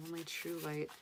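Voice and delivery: ordinary talk, nothing marked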